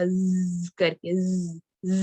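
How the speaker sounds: ز drawn out as a long buzzing zzz; buzzing z demo. Shown as a demonstration. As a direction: hold the ز and let it buzz between the teeth. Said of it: "اب اس میں buzzing ہے تھوڑا سا". (ز کو لمبا کھینچ کر بزنگ کے ساتھ)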